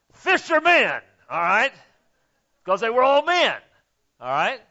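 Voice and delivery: high, loud jab at fishermen; loud emphatic correction. Real, not acted. Preacher correcting himself to the congregation, loud and emphatic. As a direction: correct the word with a punch. Preacher jokes about PC wording.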